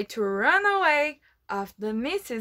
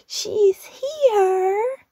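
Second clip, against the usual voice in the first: much higher, louder joyful cry; excited cheer; 10 semitones higher, 6 dB louder than usual. Acted. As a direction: cheer like the animals spotting their rescuer. Animals cheer at rescuer's arrival.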